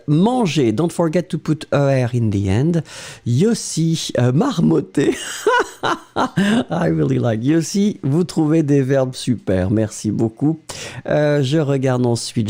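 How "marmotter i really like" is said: delighted laugh. Real, not acted. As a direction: laugh with pleasure at the word. Delighted by unusual verb.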